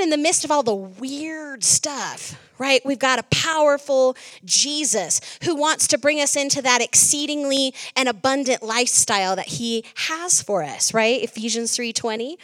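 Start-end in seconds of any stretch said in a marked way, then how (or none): none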